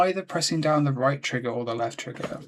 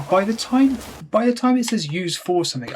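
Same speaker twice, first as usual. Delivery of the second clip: loud, high-pitched protest; raised voice, genuinely annoyed; exasperated outburst; 7 semitones higher, 6 dB louder than usual. Real, not acted. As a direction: burst out in frustration at the game. Venting at unreadable game prompt.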